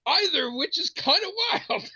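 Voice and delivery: high-pitched, incredulous wrap-up; emphatic retold argument. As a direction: land the absurd conclusion up high.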